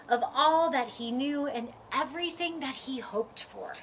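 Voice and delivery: ordinary delivery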